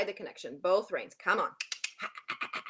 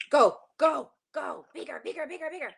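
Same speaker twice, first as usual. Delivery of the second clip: loud driving go go; urging shout; 5 semitones higher, 5 dB louder, faster than usual. Real, not acted. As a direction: push him on, shouting. Coach urging bigger canter.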